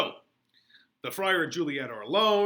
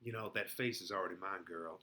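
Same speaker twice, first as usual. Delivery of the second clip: smug claim; 7 semitones lower, 10 dB quieter than usual. Retold or imitated. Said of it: "and he's like".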